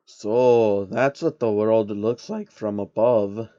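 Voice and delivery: a hint of a scheming old man; slow and measured; lightly voiced sly old man. Soros voiced.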